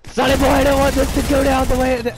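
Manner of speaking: loud, high shouted plea; hammed-up high-pitched shout; mock warning yell. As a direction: yell it dramatically at your opponent. Mock warning to another player, yelled.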